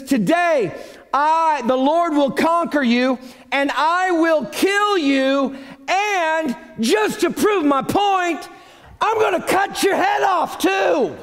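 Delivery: high, slow, emphatic battle boast; confident David. Acted. David promising Goliath defeat, confident rising shout. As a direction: build word by word into a shouted threat. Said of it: "Dude has got a strong confidence".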